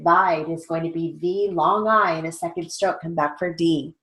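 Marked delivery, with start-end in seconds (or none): none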